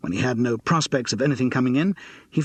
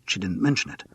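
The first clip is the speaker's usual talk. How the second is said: ordinary delivery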